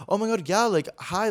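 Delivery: high-pitched, breathless "Oh my God, yeah, like, hi."; gushing fan. Retold or imitated. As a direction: squeal it like a starstruck fan. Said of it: "I didn't say it like that".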